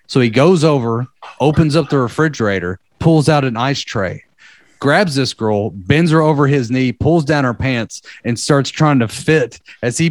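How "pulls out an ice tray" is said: normal talking voice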